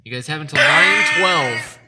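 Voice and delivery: loud, high announcer call; booming announcement. Acted. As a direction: boom it out like a game show host. Host booms deadline announcement.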